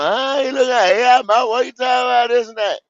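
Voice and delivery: scoffing impression of the critics; mock critic. Host mimics doubters of his AI models, scoffing.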